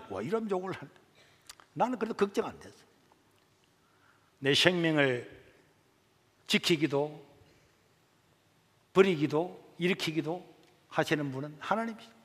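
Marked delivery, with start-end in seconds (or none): none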